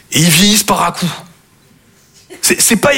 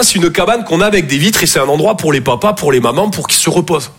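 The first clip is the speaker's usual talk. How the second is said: ordinary talk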